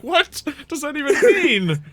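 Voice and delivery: high, loud, laughing confusion; baffled guard. Acted. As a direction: squeak it out, totally puzzled. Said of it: "looks at you like".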